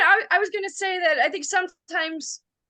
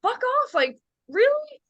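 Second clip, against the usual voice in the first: mock disbelief; 8 semitones higher than usual. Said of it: "I was like".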